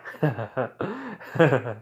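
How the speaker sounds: real burst of odd giggling; helpless stuttering laugh; weird laugh. Real, not acted. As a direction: crack up at your own joke. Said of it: "Sorry, I'm laughing weird".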